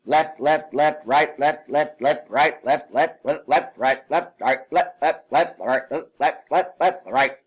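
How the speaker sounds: fast loud run of left and right; rattled-off cadence. Shown as a demonstration. Instructor showing a wrong, rushed marching call. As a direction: rattle them off without a beat. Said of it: "I don't wanna be saying left".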